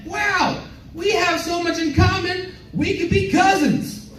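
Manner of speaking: high, slow awkward compliment. Retold or imitated. Comic on a date blurting the cousins line, high and slow. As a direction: say it up high and slow, painfully earnest. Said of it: "I said".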